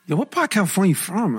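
Filipino-accented lady, mimicked. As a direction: imitate an inquisitive Filipino lady's accent. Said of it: "She got the accent still".

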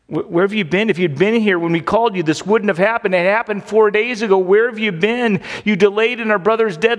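grieving sisters rebuking Jesus. Retold or imitated. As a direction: scold him for coming late. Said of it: "They're rebuking the Lord".

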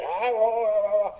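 boy shouting into a cave, imitated high shrieks; child's shrieks. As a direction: shriek out high like a kid testing an echo. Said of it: "el chiquillo empieza a chillar".

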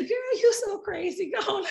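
high-pitched, put-on laughing dismissal. Bride brushing off her friend's offer, laughing it off.

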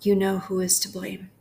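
unhurried prayer with long pauses between phrases; guided prayer meditation. Acted. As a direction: pray it phrase by phrase, leaving room to repeat.

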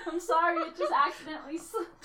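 high, giggling excuse; laughing. Real, not acted. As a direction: say it through giggles. Laughing apology.

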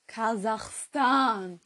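very slow, stretched "Kasachstan" at full voice; Aussprache vorgesprochen. Shown as a demonstration. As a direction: draw out every syllable of "Kasachstan". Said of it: "so spricht man das aus". (Kasachstan überdeutlich langgezogen)

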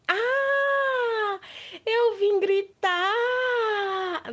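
big high playful yell; shouting. Shown as a demonstration. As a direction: shout it out to the kids. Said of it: "Eu iria deixar vocês surdos". (grito alto e brincalhão)

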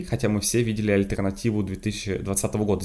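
ordinary delivery

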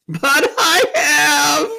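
very slow, loud, high howl; drawn-out hammed-up sobbing cry; wailed admission. A narrator's reading. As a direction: bawl each word through tears. Tumnus wails his guilt.

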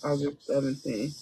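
soft murmur, repeating the date to herself while noting it; murmured while writing. Real, not acted. Host repeating the date to herself while writing, soft murmur. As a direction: say it under your breath as you jot it down. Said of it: "Let me write this down".

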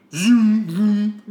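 high, loud mouthed zooms; lightsaber whoosh. Pretend. Narrator lights two lightsabers, high mouthed whoosh. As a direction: buzz out the igniting blades.

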